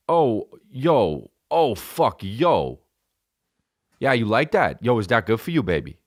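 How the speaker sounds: mock love talk in thick Jersey; Jersey accent. Comic acting out how he finishes, hammed-up Jersey accent. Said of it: "I have a fucking serious Jersey accent".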